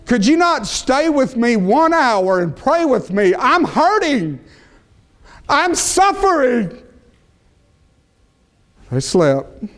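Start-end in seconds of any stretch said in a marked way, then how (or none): 0.1-6.8 anguished plea. Acted. Jesus pleads with sleeping friends.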